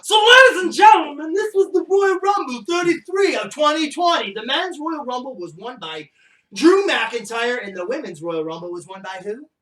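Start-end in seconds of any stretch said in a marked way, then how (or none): 0.0-7.6 announcer voice. Pretend. Announcing the Rumble winners.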